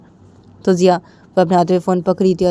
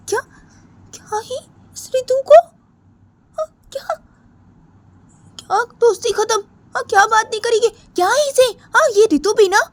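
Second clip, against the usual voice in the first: shocked, much higher, close to tears; stunned girl; 4 dB louder than usual. Acted. Sneha alone after Ritu hangs up, shocked and hurt. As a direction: disbelief turning to hurt.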